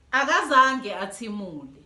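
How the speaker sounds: plain reading voice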